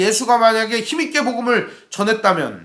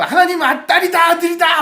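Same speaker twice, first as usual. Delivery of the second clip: mock shout; 8 semitones higher than usual. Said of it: "막 이러면 어떻겠어요".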